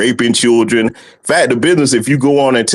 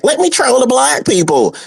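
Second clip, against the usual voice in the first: much higher nerdy parody voice; mock nerd; 14 semitones higher than usual. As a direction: squeak it like a goofy nerd. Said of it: "only a goofy nerd would".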